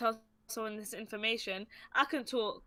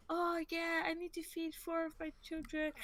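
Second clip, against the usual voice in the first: high, softer mock shopper; chatty northern lady; 7 semitones higher, 7 dB quieter than usual. Lady oversharing about her children to a stranger, high chatty voice. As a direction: ramble in a high, quiet put-on voice. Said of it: "There was just a".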